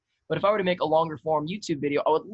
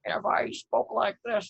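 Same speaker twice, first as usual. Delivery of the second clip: put-on higher, slow old-lady voice; 6 semitones higher, slower than usual. Shown as a demonstration. Showing how a skit grandma sounds without an accent, higher and slow.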